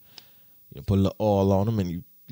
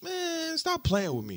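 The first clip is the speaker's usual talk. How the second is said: mock scoff; 4 dB quieter than usual. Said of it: "I'm like".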